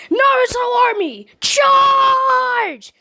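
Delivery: high, loud rallying shout; battle cry. Acted. Naruto rallying his clone army into battle, shouting. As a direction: bellow the charge, stretching the last word.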